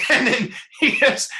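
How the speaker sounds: laughing through the words; a real chuckle spilling into the line; amused laugh while telling. Real, not acted. Laughing at own story.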